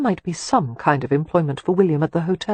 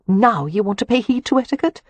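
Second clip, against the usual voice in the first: higher, sharp annoyed question; 6 semitones higher than usual. A narrator's reading. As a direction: needle him for his sudden manners. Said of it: "she asked in annoyance".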